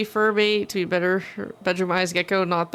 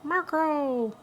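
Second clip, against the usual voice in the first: calling out; 9 semitones higher, 4 dB quieter than usual. Acted. Calling out to someone.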